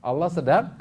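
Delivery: loud, high, emphatic sermon; forceful preaching. Real, not acted.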